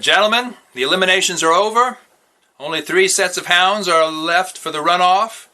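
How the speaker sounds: a lightly voiced judge speaking to the crowd; head judge's address. A narrator's reading.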